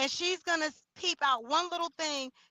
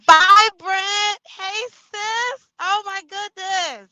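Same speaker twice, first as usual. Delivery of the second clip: high, loud, delighted shout-out; excited greeting; 7 semitones higher, 12 dB louder, much slower than usual. Real, not acted. Streamer spots Vibrant joining the live, real excited greeting. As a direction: call out happily to a friend who just showed up.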